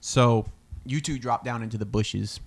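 usual speaking voice

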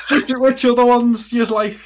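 laughing through the words. Real, not acted. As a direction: crack up while asking. Laughing at his own joke.

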